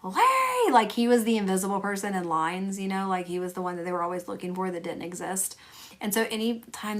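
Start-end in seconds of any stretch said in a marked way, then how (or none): 0.0-0.7 high drawn-out call of Larry; yelling Larry. Retold or imitated. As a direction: call the name across a room, high and long. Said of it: "when they would yell".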